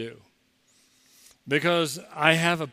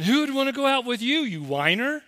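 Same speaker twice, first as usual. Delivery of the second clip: much higher, fast mocking jab; scornful retort; 9 semitones higher than usual. Preacher's silent retort to a whining kid, scornful. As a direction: toss it back in disbelief, quick. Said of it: "And I'm thinking".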